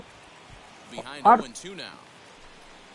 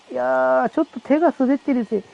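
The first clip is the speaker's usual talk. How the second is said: excuse-making player; 5 semitones higher than usual. Acted.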